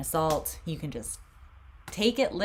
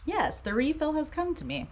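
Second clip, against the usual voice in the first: mock triumphant; 11 semitones higher than usual. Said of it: "now I'm like".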